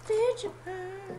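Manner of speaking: very high, soft and drawn out; silly high voice. Pretend. Player toying with a silly phrase, high and stretched.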